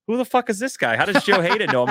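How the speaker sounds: high-pitched disbelief, who is this guy; mock-stunned onlooker, voice jumping up; baffled crowd. Crowd baffled by Joe's greeting.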